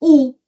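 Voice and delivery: "u" punched loud on its own; "u" said as one strong beat; stressed syllable alone. Shown as a demonstration. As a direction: isolate the "u" firmly. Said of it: "sílaba tônica".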